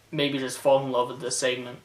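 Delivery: usual speaking voice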